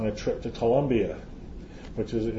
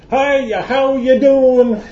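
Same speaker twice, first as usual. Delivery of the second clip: hammed-up American greeting, much higher and louder, slow and laughing; a loud, over-the-top American hello, stretching the words through a laugh; overdone American accent; 10 semitones higher, 9 dB louder, slower than usual. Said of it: "And so I choose American".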